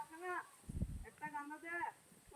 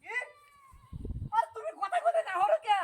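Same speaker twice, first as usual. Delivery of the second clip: sudden yell; 9 semitones higher, 18 dB louder than usual. Acted. Sudden outburst at partner.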